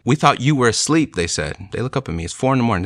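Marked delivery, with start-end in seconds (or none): 0.0-1.1 mildly startled, pitched higher; lightly imitating friends caught off guard; surprised. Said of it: "they say in that moment".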